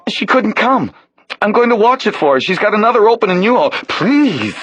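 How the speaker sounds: put-on high, pleading young man's voice; a rattled stranger begging to be let go; nervous young man. Realtor's helper pleading with the man who grabbed him, nervous.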